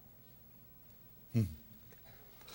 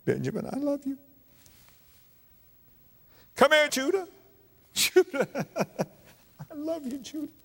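tender love; 13 semitones higher than usual. Acted. Joseph embracing his brothers tenderly.

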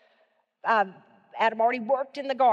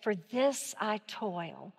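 slow, much softer, musing; hesitant, wondering aloud; tentative statement; 3 semitones lower, 7 dB quieter, much slower than usual. Shown as a demonstration. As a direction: say it like you're still thinking it through. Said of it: "it's gonna be tentative".

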